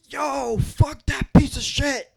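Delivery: retold yell, loud and high, dragged out slowly; furious shout. Ex-con yelling at the drunk driver, furious shout. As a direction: bellow it like a man losing it on the street. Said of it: "He's going nuts".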